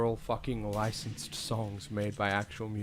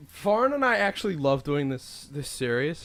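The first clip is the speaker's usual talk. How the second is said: sarcastic; 4 semitones higher, 6 dB louder than usual. Pretend. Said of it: "But, like, you hate it".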